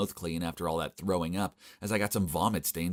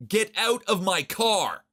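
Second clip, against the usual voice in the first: put-on slow, louder and higher yell at the kid; shouting; 12 semitones higher, 5 dB louder, slower than usual. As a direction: bark it out to throw him out. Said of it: "So I shouted at the kid".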